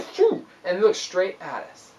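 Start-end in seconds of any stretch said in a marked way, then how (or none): 0.0-0.4 seal snort. Retold or imitated. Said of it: "it's like".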